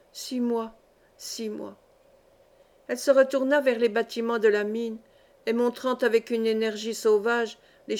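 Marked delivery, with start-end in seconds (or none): 0.1-1.8 fading repetition. Acted. Madwoman trailing off in grief.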